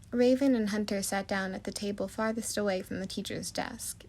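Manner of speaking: ordinary delivery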